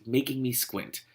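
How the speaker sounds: ordinary talk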